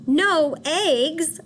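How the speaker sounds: high, loud disbelieving "No eggs?"; shocked cry. Acted. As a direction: yelp it out in outrage. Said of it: "cried Farmer Brown".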